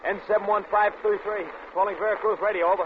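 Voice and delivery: loud radio call over noise. Acted. Pilot calling Veracruz over the plane radio, loud. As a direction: shout the call sign into the mic.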